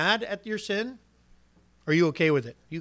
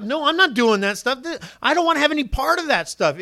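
higher and louder, fed-up no; indignant refusal; 7 semitones higher, 5 dB louder than usual. Acted. Preacher acting a believer refusing sin, indignant and loud. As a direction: push the temptation away hard. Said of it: "the next time it comes up".

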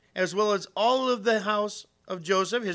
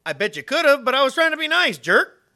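sarcastic, louder and higher; mock snide retort in his head; snarky inner thought; 8 semitones higher, 10 dB louder than usual. Mocking the woman who brushed him off, sarcastic. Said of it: "but what I thought was".

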